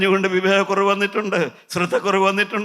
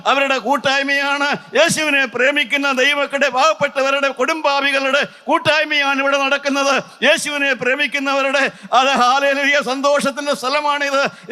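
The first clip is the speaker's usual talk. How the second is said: raised, ringing pulpit voice; fervent preaching; 9 semitones higher, 4 dB louder than usual. Acted. Preacher rousing the congregation in full fervent preaching voice. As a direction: preach it out high to the crowd.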